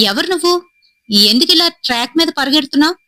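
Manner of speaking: a hint of a startled man shouting a question; calling out loudly. Signalman calls to the boy on the track, startled. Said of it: "అని గట్టిగానైతే అన్నాడు".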